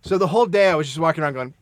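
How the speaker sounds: ordinary delivery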